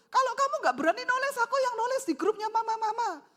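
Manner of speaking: fast, high and loud husband; worked-up, emotional. Acted. Husband offering to write to the mothers' group, worked-up. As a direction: take over hotly as the acted husband. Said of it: "ojo emosi".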